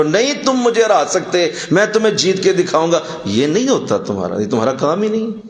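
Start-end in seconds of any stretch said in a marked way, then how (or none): 0.0-3.0 high-pitched mock defiance; argumentative boast. Preacher mimics a man bent on winning, combative. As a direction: play someone desperate to win the argument. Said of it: "بار بار جیتنے کی کوشش نہ کرو".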